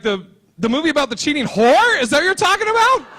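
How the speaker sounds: loud, rising-pitch indignant question; acted outraged comeback; incredulous outrage. Acted outraged reply to the date.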